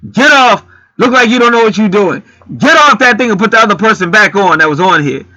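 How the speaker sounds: mock the pushy customer; imitating rude customer. Mimicking the customer ordering him off the register, loud and bossy. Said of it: "when that other motherfucker told me".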